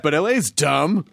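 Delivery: San Francisco accent, lazy questioning lilt. Shown as a demonstration. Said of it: "it sounds more like this".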